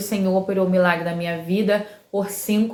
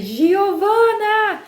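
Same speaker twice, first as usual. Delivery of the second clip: high, drawn-out yell for her daughter; calling shout; 12 semitones higher, much slower than usual. Retold or imitated. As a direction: call the name out long and very high. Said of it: "Dei um grito". (grito agudo e prolongado chamando a filha)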